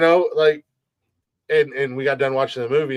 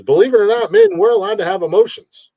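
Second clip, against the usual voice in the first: emphatic outburst; 9 dB louder than usual. Real, not acted. Emphatic point about men's feelings.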